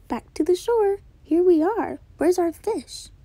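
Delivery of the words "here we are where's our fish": ordinary delivery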